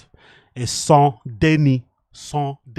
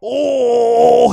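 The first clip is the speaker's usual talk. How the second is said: excited shout; 8 semitones higher, 9 dB louder than usual. Real, not acted. Big hit in the fight.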